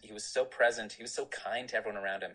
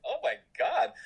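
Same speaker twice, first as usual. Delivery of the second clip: mock astonishment; 14 semitones higher, 6 dB louder than usual. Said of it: "And I was like".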